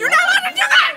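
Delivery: high, loud, fast laughing outcry; laughing protest. Real, not acted. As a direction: protest loudly through laughter. Protesting a game move.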